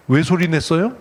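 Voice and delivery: ordinary talk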